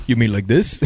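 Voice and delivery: a laugh running through the quoted line; laughing movie quote. Retold or imitated.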